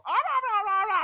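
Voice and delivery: very loud, high Muppet babble; mock Muppet. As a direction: squawk nonsense like a puppet. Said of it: "talking like a fucking Muppet".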